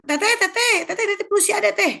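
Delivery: high-pitched acted holler; calling out. Mother calling elder sister to handle the little one, hollering. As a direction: summon big sister from another room. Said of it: "teriak ke bapaknya".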